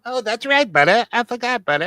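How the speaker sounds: high, loud mock Canadian accent. Answering the Canadian bit in a high, loud Canadian accent. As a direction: play along as a squeaky Canadian.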